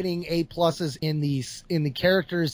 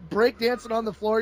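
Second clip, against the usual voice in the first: normal talking voice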